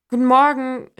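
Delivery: greeting the neighbour, called out loud. A narrator's reading. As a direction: call "Guten Morgen" over to the neighbour. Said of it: "rief ich und winkte".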